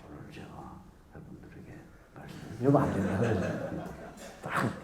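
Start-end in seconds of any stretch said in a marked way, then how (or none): 0.1-2.6 barely audible whisper; inaudible whisper. Shown as a demonstration. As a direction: mumble so no one hears. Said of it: "앞에 사람도 안 들리는 소리로".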